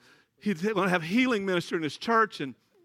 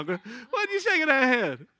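slow, drawn-out teasing question; slower teasing repeat; 3 semitones higher, 3 dB louder, slower than usual. Real, not acted. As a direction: lean on shaking, slower.

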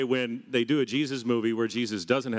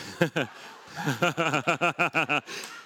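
bubbling, higher-pitched run of chuckles; lingering laughter; 4 semitones higher than usual. Real, not acted. Preacher trying to resume the sermon, laughing. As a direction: break off into leftover laughter. Still amused by the bit.

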